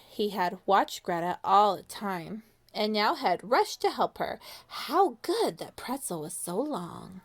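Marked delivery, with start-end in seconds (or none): none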